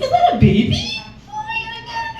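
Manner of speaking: put-on high squeal at the baby squirrel; squealing excitement. She squeals on seeing a baby squirrel in the street, delighted. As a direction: shriek it like you just spotted something adorable.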